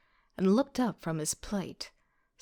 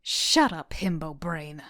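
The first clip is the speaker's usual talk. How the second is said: annoyed snap; 3 semitones lower, 5 dB louder, slower than usual. A narrator's reading. Snapping at friend.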